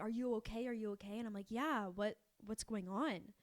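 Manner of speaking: normal talking voice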